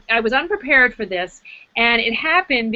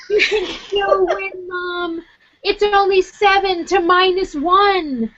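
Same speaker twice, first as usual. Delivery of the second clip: son's voice; 9 semitones higher, slower than usual. Retold or imitated. Son voiced in story.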